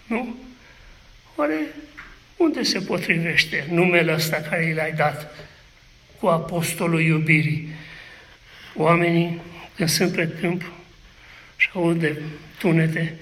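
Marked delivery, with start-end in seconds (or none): none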